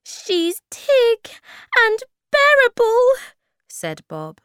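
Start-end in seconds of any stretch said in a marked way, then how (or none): 0.0-3.1 very high, loud, slow wail; squealing little creature in alarm; frightened tiny creature. A narrator's reading. Frightened Bob describes the Groke.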